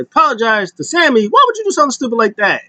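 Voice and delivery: high, disbelieving retort; mock incredulous scolding. Coach hearing of the apology, mock incredulous. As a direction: act out an appalled coach.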